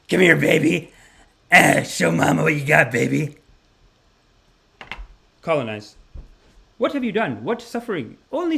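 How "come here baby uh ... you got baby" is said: loud crude come-on; brash wife. Retold or imitated. Smoker wife calling her man, loud crude. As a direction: beckon him crudely.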